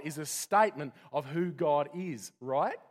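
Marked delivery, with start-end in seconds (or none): none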